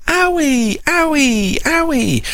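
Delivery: put-on baby voice calling her big sister; excited baby calling. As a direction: squeal owie like a delighted baby. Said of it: "And Cara will say".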